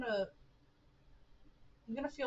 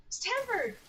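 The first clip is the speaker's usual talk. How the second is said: high alarmed call; 12 semitones higher than usual. Acted. Calling out to character.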